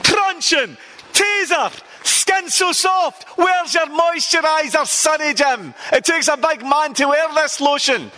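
high, shouted tough-cop barks; mock police yell. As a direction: bark it like a hard-man copper.

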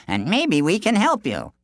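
confident villager. Acted. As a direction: reassure the runner. Villagers offer help.

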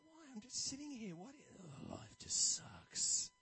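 mock mopey grumble trailing to a whisper; lightly voiced gloomy barber; gloomy hairdresser. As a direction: sigh out how life just sucks. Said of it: "really negative hairdresser".

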